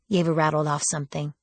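plain reading voice

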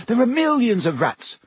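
high, indignant objection; protesting Ron. A narrator's reading. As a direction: argue back hard.

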